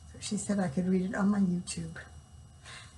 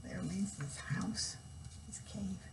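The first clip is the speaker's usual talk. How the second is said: soft whispered explanation; whispered aside; 13 dB quieter than usual. Real, not acted. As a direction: lean in and whisper it. Explaining word to children aside.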